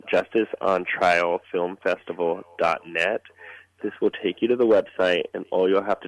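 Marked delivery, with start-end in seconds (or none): 0.1-3.2 slower. Shown as a demonstration. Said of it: "I'll say it slower".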